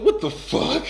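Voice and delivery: high, loud yelp of disbelief; shocked outburst. Retold or imitated. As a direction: blurt it out, stunned. His shocked reaction, retold.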